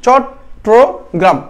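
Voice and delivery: চট্টগ্রাম very slowly in three separate beats, chot, tro, gram; split into syllables. Shown as a demonstration. Teacher showing students the three syllables, চট্টগ্রাম said slowly piece by piece. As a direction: break চট্টগ্রাম into chot... tro... gram, a clear pause after each. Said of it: "তিন ভাবে হয়েছে না?".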